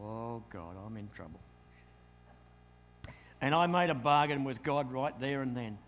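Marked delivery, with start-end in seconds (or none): 0.0-1.4 softer, lower, worried murmur; hushed dread. Retold or imitated. Young man praying as preacher nears, hushed and worried. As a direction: breathe it out in dread. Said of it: "I thought".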